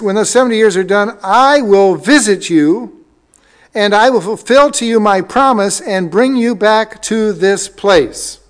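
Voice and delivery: loud, deliberate scripture proclamation, pitch lifted; forceful preaching. Acted. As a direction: proclaim the promise to the congregation with weighty pauses. Reads scripture forcefully to congregation.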